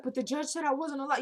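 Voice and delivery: imitating a happy-tearful woman; mock tearful hyperventilating. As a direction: plead as if overcome, hammed-up. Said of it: "she's hyperventilating and all that".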